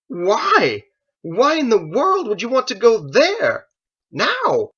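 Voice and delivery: high, loud, panicked protest; alarmed outcry. Acted. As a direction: cry it out in disbelief. Joseph alarmed at change of route.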